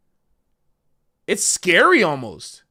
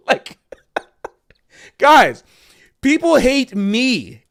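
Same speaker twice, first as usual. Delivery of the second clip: laughing through the words, slow; real laughter while telling them; 5 semitones higher, 5 dB louder, much slower than usual. Real, not acted. Telling the other musician people hate him, laughing.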